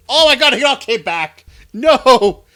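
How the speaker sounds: high, loud dismayed shout; panicked yell. Real, not acted. Enemies respawned.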